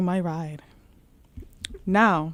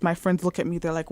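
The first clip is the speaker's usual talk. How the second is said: ordinary talk, nothing marked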